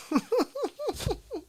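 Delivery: high, soft run of giggles. Real, not acted. Cracking up at his own silly bit, high soft giggle. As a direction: giggle helplessly at your own joke.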